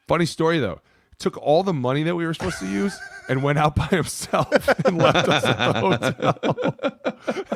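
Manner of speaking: high, loud, breaking into laughter; laughing retelling. Retold or imitated. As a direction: retell the friend's story while cracking up. Friend tells on him, laughing retelling.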